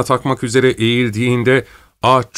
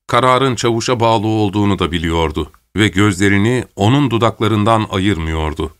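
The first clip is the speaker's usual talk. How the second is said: plain reading voice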